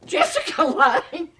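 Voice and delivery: loud, high, drawn-out disbelief; slow incredulous squeal, rising at the end; starstruck astonishment. Retold or imitated. Actor told he will meet Jessica, hammed-up astonishment.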